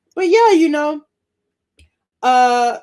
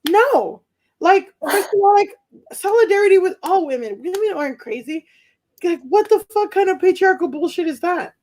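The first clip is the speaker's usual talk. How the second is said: higher-pitched righteous protest; mock-outraged inner feminist; indignant feminist; 6 semitones higher than usual. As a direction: object on principle, voice raised in pitch. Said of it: "the feminist part of me is like".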